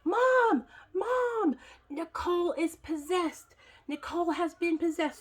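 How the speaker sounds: high-pitched cry of alarm for mom; imitated screaming. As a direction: sister shrieking in panic, acted out. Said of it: "and she screams".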